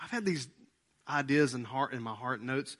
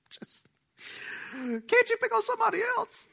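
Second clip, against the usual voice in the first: high, put-on pleading voice with a laugh; hammed-up complaint to the Lord; mock whiny plea to God. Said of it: "and I'm going like".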